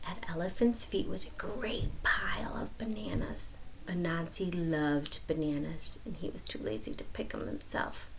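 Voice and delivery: soft, unvoiced storyteller whisper. A narrator's reading. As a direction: let the kids lean in. Whisper narration.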